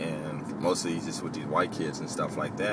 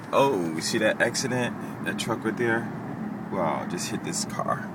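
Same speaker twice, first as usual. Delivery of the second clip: surprised; 5 semitones higher, 4 dB louder than usual. Real, not acted. Spots a car accident while driving.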